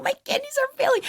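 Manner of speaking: very high mock-tragic wail; dramatic wail. Claiming her kidneys fail, a very high mock wail. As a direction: ham up the dying.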